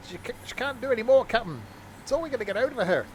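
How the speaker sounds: high, hammed-up Scottish engineer; a bad stab at a Scottish accent, pleading with the captain; Scottish accent attempt. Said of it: "that was an attempt at a Scottish accent".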